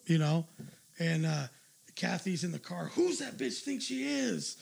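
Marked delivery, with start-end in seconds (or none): 2.9-4.6 fired-up wife. Retold or imitated. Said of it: "she was so fired up".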